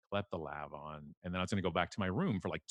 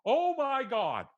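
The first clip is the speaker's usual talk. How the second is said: stunned realization; 8 dB louder, much slower than usual. Retold or imitated. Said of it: "I'm like".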